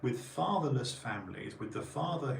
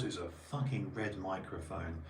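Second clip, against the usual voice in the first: ordinary talk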